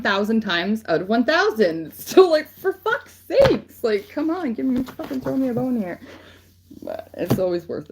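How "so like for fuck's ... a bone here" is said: fed-up cry of complaint; exasperated outburst. Real, not acted. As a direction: beg the heavens in frustration. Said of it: "exasperated plea to the divine".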